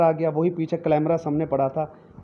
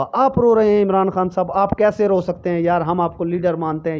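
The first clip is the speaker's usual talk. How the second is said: upset supporter; 3 semitones higher, 5 dB louder than usual. Pretend. A follower protests to the weeping leader, loud and pleading. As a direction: cry out in dismay to your leader. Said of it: "वो कहेगा".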